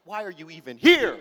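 mock outrage, bursting loud on HERE; fed-up comic complaint; exasperated. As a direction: build to an indignant blurt on the last word. Exasperated at wife who didn't film.

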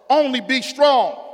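loud, high preacher's cry of God's words; booming pulpit proclamation; full-force preaching. Acted. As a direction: preach it out, rising and ringing. Preached command.

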